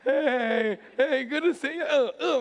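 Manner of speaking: high, loud, forced cheery greeting; strained, over-friendly pastor hello; fake smile. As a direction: greet them through a pasted-on smile. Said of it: "one of those fake smiles".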